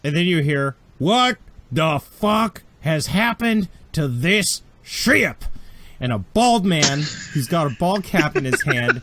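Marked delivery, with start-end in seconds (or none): 1.0-5.4 put-on slow drawling accented outrage, pitched up; accented old captain's angry bellow; gruff spacer accent. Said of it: "You notice the accent".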